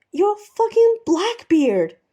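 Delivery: very high, very loud, slow; furious yell. Acted. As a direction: hurl it at him in exasperation. Steed yelling in exasperation.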